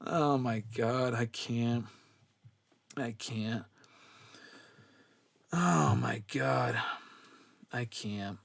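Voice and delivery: breathless after a laughing fit; worn out from laughing. Real, not acted. Host recovering from chat jokes, soft and spent. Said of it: "I'm dying here".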